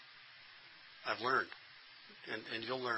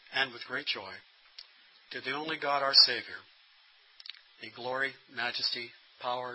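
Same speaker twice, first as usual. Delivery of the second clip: benediction. Acted. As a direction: bless them as you close. Closing benediction.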